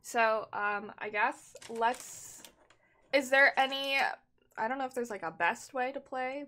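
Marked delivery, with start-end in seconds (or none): none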